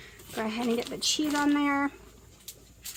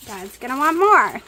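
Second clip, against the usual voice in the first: loud; 13 dB louder than usual. Real, not acted. Calling out while cooking.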